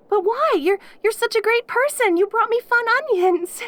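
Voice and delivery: can't believe anyone would leave them alone; incredulous. Acted. Demon protests that the visitor's family ignores them, incredulous.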